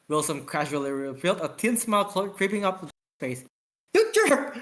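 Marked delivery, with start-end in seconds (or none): 3.9-4.6 very high, raised indignant yell. Acted. Captain yells at tricky lieutenant, very high indignant yell. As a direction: shriek it at him, high and loud.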